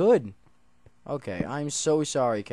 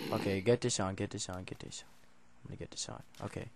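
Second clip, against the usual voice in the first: fading to a whisper; hushed mutter; 8 semitones lower, 13 dB quieter, faster than usual. Real, not acted. Player equipping armour, whispering to himself. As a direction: murmur it to yourself. Hurried hushed self-talk.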